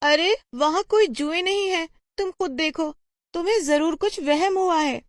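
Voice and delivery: high-pitched anxious mother voice; worried mother. Acted. A mother asks why Reshma vomited, high and worried. Mother dismisses daughter's fear.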